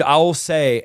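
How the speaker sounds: normal talking voice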